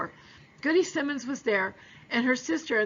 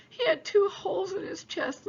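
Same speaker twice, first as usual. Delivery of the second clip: higher, voice cracking with tears; a small boy near crying, telling it; tearful boy; 8 semitones higher than usual. A narrator's reading. Frightened boy describing father's wounds.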